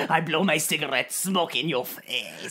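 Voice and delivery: lazy, contemptuous mock Frenchman; French accent. Co-host as ticket seller insults traveller, mock French accent. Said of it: "in his French accent".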